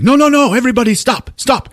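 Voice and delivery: high, panicked shout; acted urgent yelling; retold frantic command. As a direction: call out high to halt a mob. Retold Javier halting the mob.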